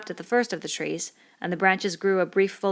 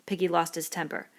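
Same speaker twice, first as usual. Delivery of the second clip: ordinary delivery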